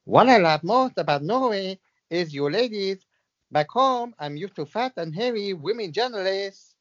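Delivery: badly faked, sing-song Latin accent; Diego Maradona. Medium channels a footballer, badly faked Latin accent. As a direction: put on a dubious lilting Latin accent. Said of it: "'Cause I can't do the accent".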